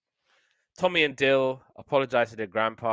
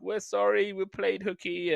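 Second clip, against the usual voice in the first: put-on high, apologetic kid voice; lightly voiced sorry children; sheepish kids; 9 semitones higher than usual. Caught truant kids apologising to police, sheepish and high.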